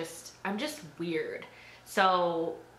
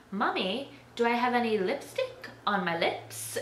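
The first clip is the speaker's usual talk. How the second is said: lightly imitated little kid, higher pitch; five-year-old self; 5 semitones higher than usual. Five-year-old asking mom about lipstick after church, higher kid voice. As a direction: play your small self asking mommy. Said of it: "I was, like, five years old".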